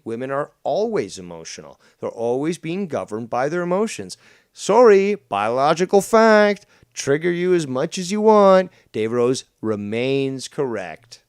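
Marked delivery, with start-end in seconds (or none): 4.5-8.7 loud provoking. Real, not acted. Provoking the caller loudly.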